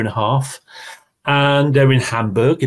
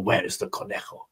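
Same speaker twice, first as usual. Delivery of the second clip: hushed searching whisper; 11 dB quieter than usual. Acted. Jaguar hunting the rabbit in the forest, whispered. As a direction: whisper it like a hunter creeping.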